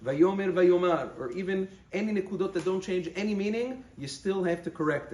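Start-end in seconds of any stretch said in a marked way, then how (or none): 0.0-1.1 Vayomer then Vayomar, slow, the last vowel changing from e to a. Shown as a demonstration. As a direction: contrast Vayomer and Vayomar one after the other. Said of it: "any mistake in the Nekudot".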